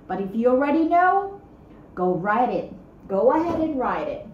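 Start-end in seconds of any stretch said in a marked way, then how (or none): none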